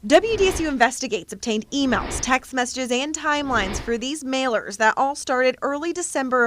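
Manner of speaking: measured reporter's news read; investigative news exposé. A narrator's reading.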